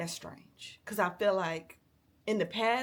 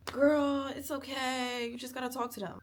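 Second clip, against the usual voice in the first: higher, soothing mock friend; mock advice voice; 4 semitones higher than usual. Mimicking friends who urge her to talk, lightly voiced. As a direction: imitate the well-meaning friends' advice.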